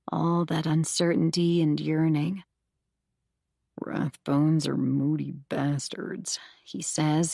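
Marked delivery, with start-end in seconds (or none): 3.8-6.4 slow scratchy whisper. Acted. Zach on the phone at four a.m., slow scratchy whisper. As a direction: rasp it out under your breath, slowly. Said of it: "his voice a scratchy whisper".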